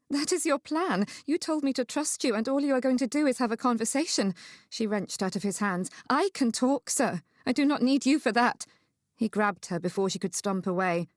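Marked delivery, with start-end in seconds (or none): 0.1-4.3 high, indignant outburst; higher-pitched, scornful protest; incredulous. Acted. Incredulous outburst at his plan. 6.1-8.6 high, emphatic retort; higher-pitched, offended rebuff; indignant. Acted. She pulls away indignantly.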